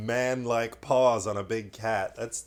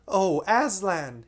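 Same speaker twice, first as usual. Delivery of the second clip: high, loud, slow joyful cry; 10 semitones higher, 7 dB louder, slower than usual. Acted. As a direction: call out in awe. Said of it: "he cried".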